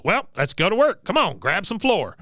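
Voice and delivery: high-pitched mock drill instructor; Cotton. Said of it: "and he goes".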